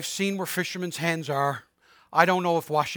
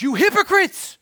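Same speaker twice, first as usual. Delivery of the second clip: much higher, louder outraged cry; exclaimed rebuke; 11 semitones higher, 7 dB louder than usual. Retold or imitated. Jesus rebuking the Pharisees, a loud high exclamation. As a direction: fling it out with an exclamation point. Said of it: "So even Jesus goes".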